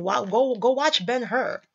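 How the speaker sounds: usual speaking voice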